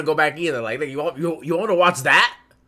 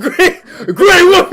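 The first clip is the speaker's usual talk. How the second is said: laughing; 12 semitones higher, 11 dB louder, slower than usual. Real, not acted. Laughing at the long name.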